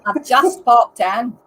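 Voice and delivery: put-on loud, slow Irish-accent echo; mock Irish accent. Teasing a friend's attempt with loud, slow mock Irish. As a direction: punch out each word in mock Irish.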